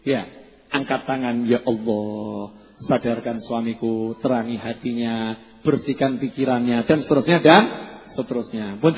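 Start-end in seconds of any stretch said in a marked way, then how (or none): 1.5-6.9 very slow, soft, lower plea to God; soft prayer. Retold or imitated. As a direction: plead quietly with raised hands. Acted prayer raising hands. (doa lirih memohon)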